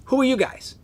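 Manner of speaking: mock challenge, pitched high; retold challenge. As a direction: imitate a stranger stopping intruders. Stranger stops and challenges them.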